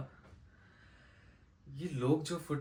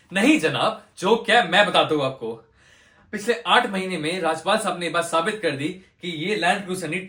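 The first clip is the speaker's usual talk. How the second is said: loud, high, scornful harangue; outraged courtroom tirade; 7 semitones higher, 11 dB louder than usual. Acted. Lawyer ridiculing Rajpal's defence before the judge, outraged. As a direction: hammer the absurd claims at full volume.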